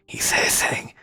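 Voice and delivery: whispered aside; hushed, voiceless aside. Acted. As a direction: whisper it confidentially to a companion. Companion murmurs aside after leaning close.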